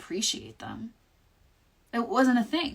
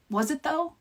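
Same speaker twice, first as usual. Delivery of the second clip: sarcastic doubt; 8 semitones higher than usual. Acted. Sarcastic self-answer.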